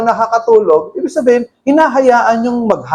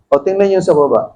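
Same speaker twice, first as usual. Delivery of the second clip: normal talking voice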